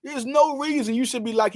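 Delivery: usual speaking voice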